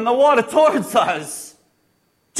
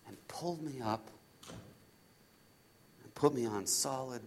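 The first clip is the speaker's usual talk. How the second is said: low, soft, slow storytelling; slow down and hush, with reverent pauses; hushed awe; 8 semitones lower, 15 dB quieter than usual. Acted. Disciple recalling Jesus lifting him from the storm, hushed awe. Retelling the rescue in calm.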